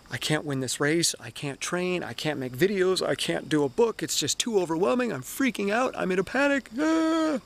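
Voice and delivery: spiral up into panic, ending in a cry; panicked self-talk. Retold or imitated. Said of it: "That's what beating yourself down looks like".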